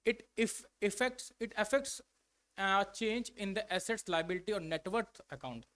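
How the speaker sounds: reads the definition very slowly, word by word; slow dictation. Shown as a demonstration. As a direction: dictate each word for the class to copy.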